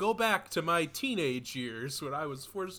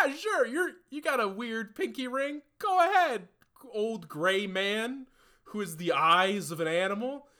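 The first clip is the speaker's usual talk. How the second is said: high, loud clueless parent voice; high mock naive parent; 7 semitones higher, 5 dB louder than usual. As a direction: gush it like a clueless mom. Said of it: "they're like".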